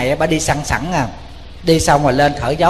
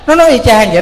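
emphatic exclaim; 7 semitones higher, 8 dB louder, much faster than usual. Real, not acted. Monk stressing son repeated it all.

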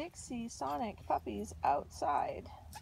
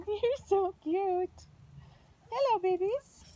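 slow, higher, laughing coo; 6 semitones higher, 3 dB louder than usual. Real, not acted. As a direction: gush over the cute pups. Cooing with a laugh.

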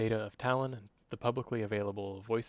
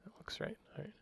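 whispered aside; 11 semitones higher, 12 dB quieter, much faster than usual. Real, not acted. Whispered aside mid dictation.

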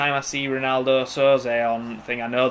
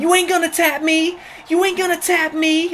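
very high mock scream. Opponent starts screaming at me over the mic, high mock screaming. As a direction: screech it like a ranting opponent, pitched way up. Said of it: "he just starts screaming".

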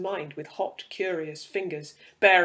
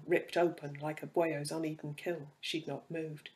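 usual speaking voice

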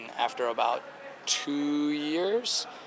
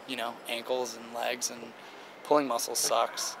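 ordinary talk

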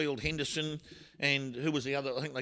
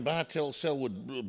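ordinary talk, nothing marked

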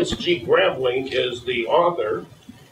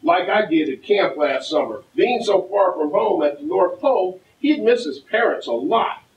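put-on reading to a toddler; 4 semitones higher than usual. Mayor reads picture book aloud.

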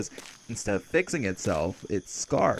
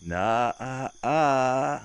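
drawn-out, higher and louder no; teasing refusal; 4 semitones higher, 5 dB louder than usual. Acted. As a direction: playfully shut down their prying. Teasing refusal to tell a secret.

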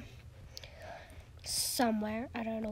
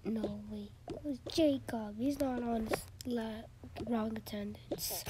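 normal talking voice

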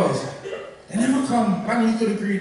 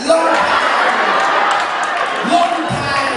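loud drawn answer; 6 semitones higher, 3 dB louder, much slower than usual. Retold or imitated. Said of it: "I'm like".

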